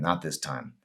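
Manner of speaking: plain reading voice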